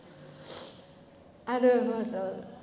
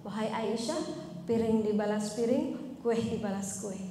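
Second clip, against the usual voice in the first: calm gentle rebuke; 5 semitones lower, 4 dB quieter than usual. Retold or imitated. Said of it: "Apa yang dikatakan Rasul".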